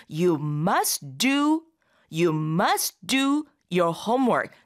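"must do" run together very slowly, do stretched; pronunciation model. Shown as a demonstration. Teacher demonstrates linking must and do, very slow. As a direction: linger on "You must do" then finish smoothly. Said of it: "이런 식으로 발음하게 되죠".

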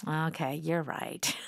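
low, soft admission of defeat; reluctant concession. Acted. Teacher acts Tom losing the argument, conceding reluctantly. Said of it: "he protested, and he's like".

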